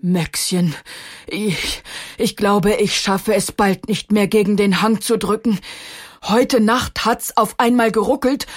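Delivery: a touch of strain, halting start; a tired old stone barely holding on; exhausted. A narrator's reading. Theodor tells Maxchen he can hardly push, exhausted. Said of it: "der ziemlich erschöpft klang".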